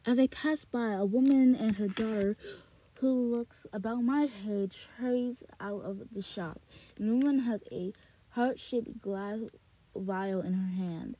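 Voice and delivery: ordinary delivery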